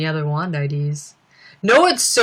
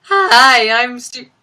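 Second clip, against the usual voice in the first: loud, higher greeting that breaks off; imitated voice crack; 4 semitones higher, 7 dB louder, much slower than usual. Streamer mimicking Beans' painful outtake, voice cracking. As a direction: start big, then lose the voice. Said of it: "voice just cracked".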